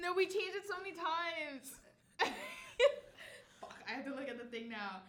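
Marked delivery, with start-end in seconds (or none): none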